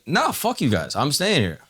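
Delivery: lightly voiced tenant telling the buyers off; defiant squatter. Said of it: "And the guy's like".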